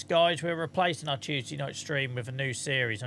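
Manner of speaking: ordinary talk, nothing marked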